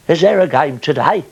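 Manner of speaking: high, loud, goofy comic voice, a bit slow; Norman Wisdom. Retold or imitated. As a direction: pop your head round the door as a daft comic clown. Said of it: "I used to do Norman Wisdom".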